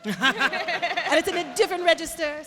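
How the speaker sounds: high, loud Jamaican accent. Shown as a demonstration. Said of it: "talking up here like this".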